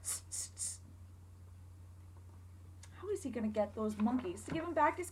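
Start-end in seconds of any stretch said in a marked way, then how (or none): 0.0-0.8 unvoiced teasing tss; monkeys' tss. Monkeys mocking the peddler with a whispered tss. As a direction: hiss tss back like cheeky monkeys.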